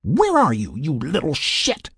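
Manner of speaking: high, indignant woman character; scolding yell. A narrator's reading. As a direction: call him out crossly, pitched high. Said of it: "she yelled".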